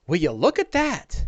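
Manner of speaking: a hint of an amazed onlooker; Bing. Game master voices companion behind the player, lightly acted amazement. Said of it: "you hear a voice from behind you from Bing".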